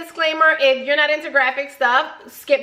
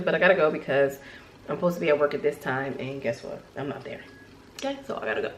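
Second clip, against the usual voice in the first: hurried and hushed; 7 semitones lower, 8 dB quieter, much faster than usual. Retold or imitated. Stressed teen rushing out.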